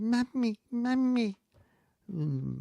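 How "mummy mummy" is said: put-on high urgent little boy calling; a child waking his mum; child's voice. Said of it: "He says".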